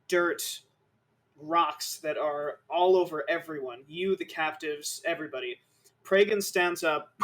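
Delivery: ordinary delivery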